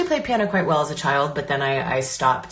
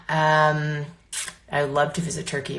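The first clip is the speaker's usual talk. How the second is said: ordinary talk, nothing marked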